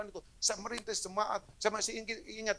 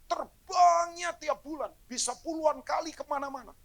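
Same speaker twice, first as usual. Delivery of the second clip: emphatic; 8 semitones higher, 6 dB louder than usual. Real, not acted. Emphatic story climax.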